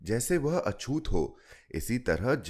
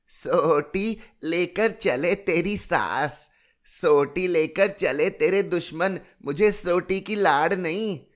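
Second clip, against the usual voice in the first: a stubborn granny refusing the stick, higher; grumbling old grandmother; 9 semitones higher, 6 dB louder than usual. A narrator's reading. Grandmother retorts to the children, higher cranky old voice. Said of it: "कुड़कर कहती".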